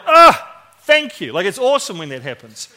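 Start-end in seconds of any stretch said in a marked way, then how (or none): 0.1-1.3 loud, high gasp of thanks; acted-out relief, pitched way up; relieved outburst. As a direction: burst out in sudden, grateful relief. Imagines getting a timely answer.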